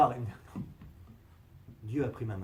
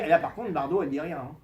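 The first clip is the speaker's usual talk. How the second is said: normal talking voice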